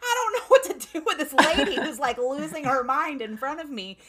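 a hint of a panicked freshman, high and loud with a laugh; mock-frantic teen stagehand, laughing through it; flustered stagehand kid. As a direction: stagehand voiced as overwhelmed by the singer's panic.

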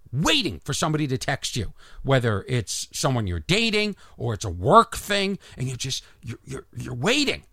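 normal talking voice

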